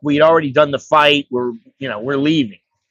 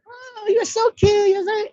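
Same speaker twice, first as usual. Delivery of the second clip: put-on cooing baby talk; 3 dB quieter than usual. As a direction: coo to the baby like a doting mother. Lady cooing at her baby.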